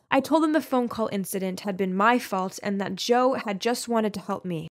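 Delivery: ordinary delivery, nothing marked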